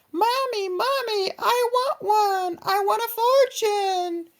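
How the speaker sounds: put-on very high, slow whining child; whiny kid voice. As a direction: squeal it like a bratty little boy. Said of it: "That's how he delivers his line".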